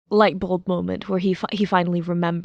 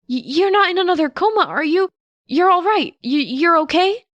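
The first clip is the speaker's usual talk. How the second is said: put-on high, loud anxious boyish stammer; a kid scared his teacher is hurt; fast, high stammering boy full of worry; worried young boy; 9 semitones higher, 5 dB louder than usual. Narrator voices worried Midoriya.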